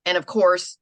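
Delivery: ordinary talk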